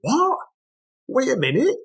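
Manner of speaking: high-pitched, baffled double take; incredulous. Retold or imitated. Listener reacts to the plot twist, incredulous. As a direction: stop short in astonished disbelief.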